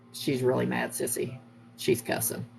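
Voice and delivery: imitating a child's secretive whisper; soft and low, fading into a whisper; hushed warning. Son secretly telling his sister about mom's temper, hushed.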